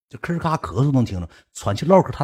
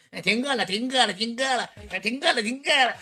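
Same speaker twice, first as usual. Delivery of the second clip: high-pitched mocking singsong; mimicked heckler; 13 semitones higher than usual. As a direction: parrot a heckler in a high silly voice. Mimics hecklers who heard it before. (尖着嗓子学人起哄)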